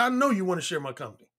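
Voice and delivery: quick, low, self-assured; cocky. Retold or imitated. Man telling Sharon what she wants, cocky. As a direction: toss it off low and fast, sure of her. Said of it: "the most cocky thing I said".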